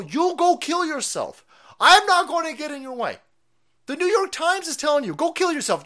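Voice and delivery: high-pitched, heated rant; indignant, emphatic scolding; angry rebuke. Real, not acted. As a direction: tell them off forcefully, pitched high. Furious rant answering the begging woman.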